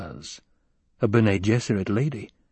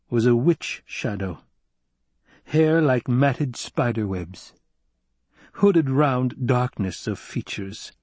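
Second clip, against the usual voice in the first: ordinary delivery, nothing marked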